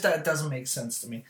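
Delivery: usual speaking voice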